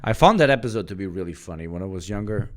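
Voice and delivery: hurried line before the redo; rushed. Real, not acted. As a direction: blurt the sentence too quickly. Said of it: "Oh, sorry".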